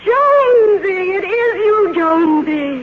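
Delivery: put-on drawn-out, delighted call to an old friend. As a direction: sing out his name warmly across the gate. Greeting old gateman from the car.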